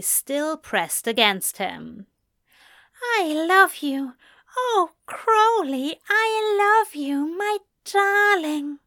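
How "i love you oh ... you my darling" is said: high, eager voice of a lover urging his partner; high, urgent lover's plea; Aziraphale, pleading with desire. A narrator's reading. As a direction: coax him higher and higher, lovingly. Aziraphale declares love.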